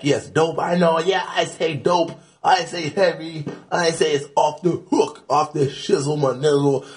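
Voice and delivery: mocking wannabe [name]. Rapper mocks copycat slang users, high mock voice. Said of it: "the wannabe [name] guy".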